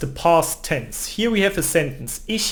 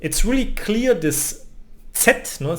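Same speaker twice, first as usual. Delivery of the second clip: ordinary talk, nothing marked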